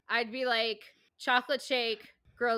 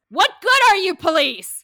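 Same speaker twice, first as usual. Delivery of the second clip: much higher and louder yell; furious shout at police; 8 semitones higher, 15 dB louder than usual. Acted. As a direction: berate the useless cops. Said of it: "they'd go to the cops and be like".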